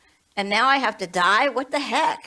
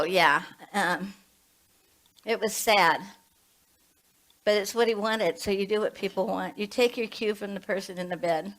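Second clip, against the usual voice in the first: usual speaking voice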